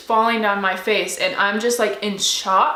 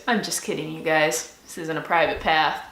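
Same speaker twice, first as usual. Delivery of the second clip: put-on old man joking; 6 semitones lower, 4 dB quieter than usual. Old man reveals his joke.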